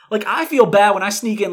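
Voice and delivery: ordinary talk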